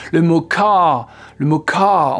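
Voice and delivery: "car" in a British accent, the final R barely heard. Shown as a demonstration. Teacher showing British "car" without its R. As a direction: let the R of "car" fade away, British. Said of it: "si on a un accent britannique".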